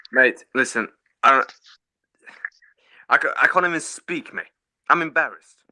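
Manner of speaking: loud, angry outburst; furious rant. Real, not acted. Fan yells at his co-host about the club, furious. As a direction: explode at him in disgust.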